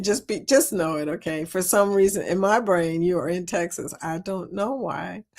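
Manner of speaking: ordinary talk, nothing marked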